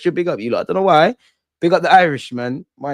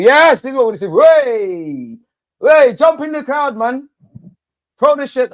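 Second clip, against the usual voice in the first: high, loud celebratory yells; elated cheering; 12 semitones higher, 4 dB louder than usual. Real, not acted. Fan cheering the team to jump in the crowd, elated. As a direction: cheer them on at the top of your voice.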